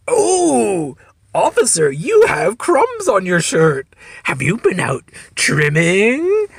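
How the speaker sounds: high, loud, drawn-out punchline; milk it slowly for the laugh; mock teasing. Imagined punchline delivered to the cop.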